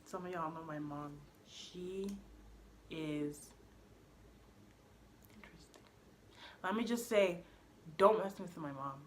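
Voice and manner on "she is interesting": loaded whisper. Real, not acted. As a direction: whisper it after a long pause, full of meaning. Loaded pause before describing mom.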